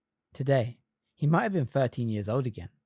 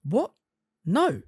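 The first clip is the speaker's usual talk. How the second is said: high, defensive denial; 8 semitones higher, 5 dB louder than usual. A narrator's reading. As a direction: push the idea away. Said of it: "he said defensively".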